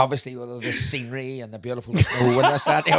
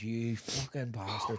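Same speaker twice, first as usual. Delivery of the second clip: soft, slow, voiceless curse; hissed whisper; 6 semitones lower, 14 dB quieter, slower than usual. Retold or imitated. Mickey on the phone to his mate, a slow whispered curse. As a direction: whisper it through clenched fury. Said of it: "he went".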